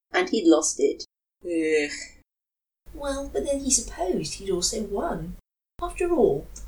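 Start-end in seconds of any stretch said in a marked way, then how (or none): none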